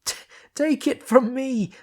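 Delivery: high, slow strangled shout. Acted. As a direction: force it out through a tight throat. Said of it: "in a strangled tone of voice".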